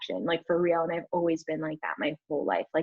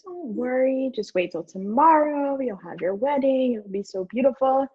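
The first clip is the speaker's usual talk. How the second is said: super chill parents; 6 semitones higher than usual. Retold or imitated. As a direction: lightly mimic relaxed in-laws soothing. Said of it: "they're super chill".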